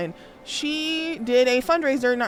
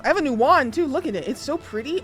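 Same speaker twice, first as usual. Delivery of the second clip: higher, delighted gushing; excited; 6 semitones higher than usual. Real, not acted. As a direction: gush over a shiny new toy. Admiring new wand.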